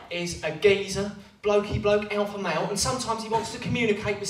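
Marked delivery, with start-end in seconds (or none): none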